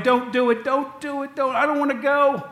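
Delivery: high, loud crying plea; condemned man begging not to die, wailing high; bawling plea. Retold or imitated. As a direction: beg for your life, sobbing. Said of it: "He starts crying".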